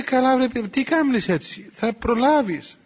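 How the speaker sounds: high, slow, drawn-out chiding; teasing reassurance. Retold or imitated. Elder to the worried abbess, teasing reassurance. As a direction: tease her for fussing, stretching the words.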